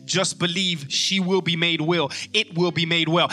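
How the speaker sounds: ordinary talk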